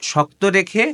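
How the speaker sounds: ordinary talk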